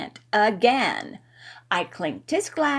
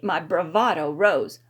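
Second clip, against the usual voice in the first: ordinary delivery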